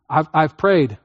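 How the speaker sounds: ordinary talk, nothing marked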